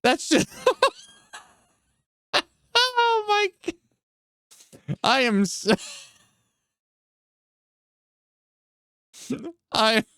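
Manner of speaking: laughing too hard to get words out; high, squeaky words broken by real laughter; breathless laughter. Real, not acted. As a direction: gasp an apology through a laughing fit. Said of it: "I can't breathe".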